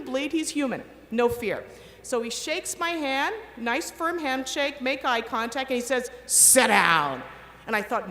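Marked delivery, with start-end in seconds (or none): 6.2-7.2 low, loud, curt order; rough command. Retold or imitated. Senior doctor ordering the candidate: low, loud, curt command. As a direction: bark it like a gruff boss. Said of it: "they are really rough here".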